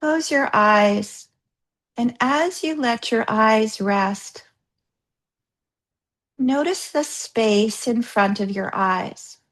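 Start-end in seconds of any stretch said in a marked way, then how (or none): none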